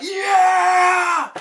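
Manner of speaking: high, loud drawn-out cheer; crowd cheer. Acted. Pirates all cheering as they set off, shouted cheer. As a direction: yell it long like a whole rowdy crew.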